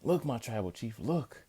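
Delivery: high, urgent look look; imitating Heyman warning his chief; mock urgent pointing. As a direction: draw his attention fast. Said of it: "Then Paul Heyman goes".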